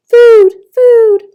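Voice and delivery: high, loud breathy little cry for food; a tiny hatchling begging to be fed; high, urgent baby-bird plea; hungry gosling. A narrator's reading. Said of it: "That tiny voice".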